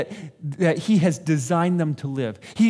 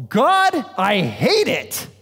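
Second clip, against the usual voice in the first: slow, louder plea of loathing; mock heartfelt hatred; 3 semitones higher, 4 dB louder, much slower than usual. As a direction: spit out the word hate. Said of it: "we go".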